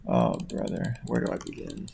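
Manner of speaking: soft, tired under-the-breath sigh; weary mutter. Real, not acted. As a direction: say it like you're already exhausted. Weary aside.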